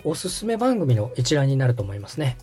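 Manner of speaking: ordinary talk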